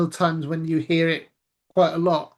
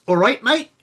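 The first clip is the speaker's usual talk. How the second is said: loud, higher Australian greeting; pitched-up, punchy Australian lilt; Australian accent; 6 semitones higher, 5 dB louder than usual. Shown as a demonstration. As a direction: demonstrate a broad Australian "mate". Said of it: "is more Australian".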